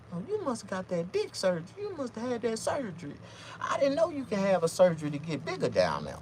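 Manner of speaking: high put-on woman's voice, lightly done; mock woman. Woman guessing he had surgery. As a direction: play the amazed girl. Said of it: "She was like".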